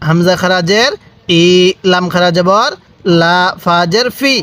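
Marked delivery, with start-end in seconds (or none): none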